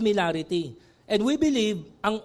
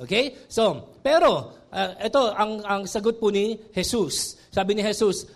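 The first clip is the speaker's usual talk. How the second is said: ordinary talk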